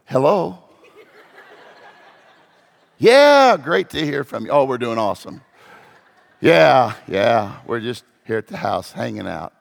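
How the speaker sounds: a bright yell on the first yeah, then dropping to calm and pleasant; sweet phone voice. Pretend. Answering a friend's call mid-fight, mock calm. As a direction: switch instantly to a polite everything-is-fine voice.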